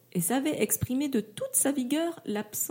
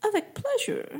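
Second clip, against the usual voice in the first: put-on much higher, pleased English-accented reply; delighted English accent; 11 semitones higher than usual. English camper accepts invitation, delighted English accent. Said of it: "ravi, répondait".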